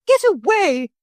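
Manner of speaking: high, loud shriek; crying out. A narrator's reading. Motor lady shrieking at the circling parrot. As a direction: shriek it at the bird. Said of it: "she cried".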